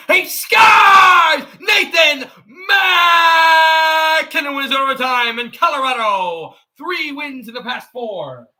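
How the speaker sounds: huge high goal shout with stretched name, easing off; Mark Moser. Acted. Streamer imitating a broadcaster on an overtime goal, roaring goal call. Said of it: "you want me to do Mark Moser".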